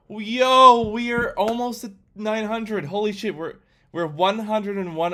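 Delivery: excited. Real, not acted. Streamer sees subscriber count jump.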